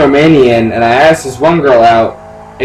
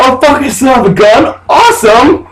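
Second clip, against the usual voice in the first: higher, delighted surprise; retold burst of pleased surprise; excited reaction; 11 semitones higher than usual. Retold reaction to finding gun.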